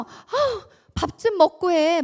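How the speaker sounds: exasperated mom yelling about dinner; scolding mother. Retold or imitated. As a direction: call out impatiently to a gamer son. Mother nagging son at dinner.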